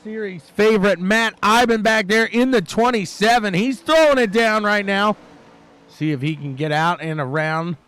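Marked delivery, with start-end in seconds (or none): 0.0-5.2 loud, punchy race call; live play-by-play. Real, not acted. Commentator picking out the series favorite, loud racing call. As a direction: push it out over the action.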